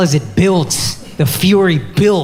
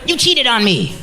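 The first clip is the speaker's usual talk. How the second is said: high, mock accusing; lightly voiced girlfriend accusing; 9 semitones higher, 4 dB quieter than usual. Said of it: "Every hour is something".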